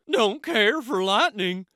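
a jittery goose admitting fear; high goose voice; nervous goose. A narrator's reading. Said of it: "said Goose".

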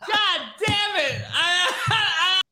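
slow, drawn-out words through laughter; helpless laughter. Real, not acted. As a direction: try to speak while cracking up. Still cracking up after the rant.

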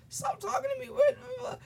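high, exasperated "leave me alone"; fed-up teen snapping back; exasperated retort. Retold or imitated. Malik snapping back at the substitute, high and exasperated. Retold classroom argument.

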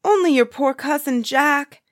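high, loud answer; calling out. A narrator's reading. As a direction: call up to the giant at the gate. Jack calls to giant at gate.

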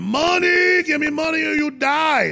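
high shouted mock threat; mock threatening migrant. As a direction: yell it in a caricature voice.

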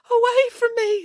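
high, tearful echo; crying echo. A narrator's reading. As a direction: repeat it back, crying.

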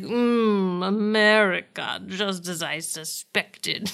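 slow, witchy mock British accent; Missus Post. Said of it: "are you doing a British accent".

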